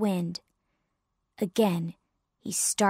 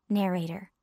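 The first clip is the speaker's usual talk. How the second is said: plain reading voice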